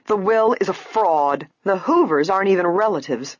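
indignant, stressed denunciation; spiteful. A narrator's reading. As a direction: exclaim it with spite. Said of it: "his wife exclaimed spitefully".

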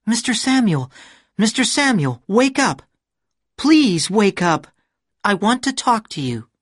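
high woman's voice calling a name. A narrator's reading. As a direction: call him awake from the dream. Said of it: "Somebody was calling my name".

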